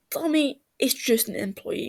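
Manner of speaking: plain reading voice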